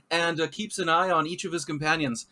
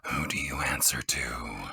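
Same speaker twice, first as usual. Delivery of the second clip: low whispered character voice; 6 dB quieter, slower than usual. Acted. Frostglade questions the Stormcast.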